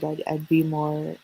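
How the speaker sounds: ordinary talk, nothing marked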